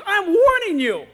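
loud, high, drawn-out threat; exasperated parental shout; warning mother. Retold or imitated. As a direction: imitate a mom's final warning, slow and forceful. Mother threatens boy in retold quarrel.

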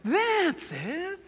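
pleased liar, drawn-out catchphrase; comedy liar character. Acted. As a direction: imitate a TV sketch fibber congratulating himself. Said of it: "He's known as the liar".

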